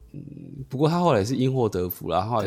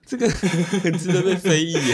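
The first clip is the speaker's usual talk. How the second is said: laughing; 7 semitones higher, slower than usual. Real, not acted. Teasing through laughter.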